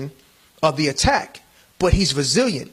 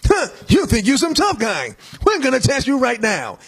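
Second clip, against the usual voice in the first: taunting tough guys, pitched way up; mock thug taunting; 8 semitones higher than usual. Thugs threaten the tea drinker.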